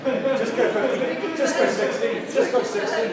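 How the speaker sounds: words riding on a laugh. Real, not acted. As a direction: urge them on while laughing. Laughter during the bidding banter.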